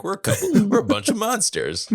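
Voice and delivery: cracking up mid-sentence; laughing while quoting. Real, not acted. Laughing through quote.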